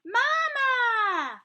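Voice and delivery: put-on high, loud, long-held baby cry of mama; gosling calling. Gosling calls to the bear it takes for mama, calling out.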